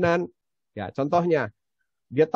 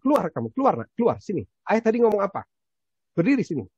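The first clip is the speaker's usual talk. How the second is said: put-on higher, firm father ordering the child out; stern order; 5 semitones higher, faster than usual. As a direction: command the kid out of the car, sharp and raised. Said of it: "saya bilang". (ayah menyuruh anak keluar dari mobil, tegas dan tinggi)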